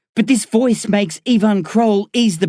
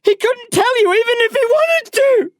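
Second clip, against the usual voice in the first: desperate high-pitched scream. A narrator's reading. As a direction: scream it out at the top of your range, stretching the words. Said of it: "I scream".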